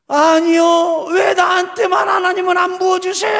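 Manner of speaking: very high, loud mock wail; imitated crying protest. Pastor voicing a student God passed over, loud wailing protest. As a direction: bawl it out, drawn out, as imitation. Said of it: "하고 또 우는 거예요".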